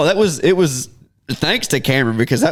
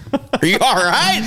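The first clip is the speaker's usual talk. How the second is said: high, slow question through laughter; amused mock alarm, drawn out; retold startled concern; 11 semitones higher, slower than usual. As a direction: check on them in a high, laughing voice. Reacting to friend's fall, amused.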